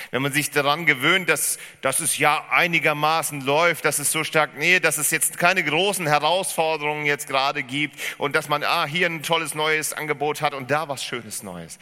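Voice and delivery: laut und hoch, sich steigernde Predigt; predigend. Acted. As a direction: predige die Warnung mit voller Kraft. Preaching at full force. (laute, eindringliche Predigt)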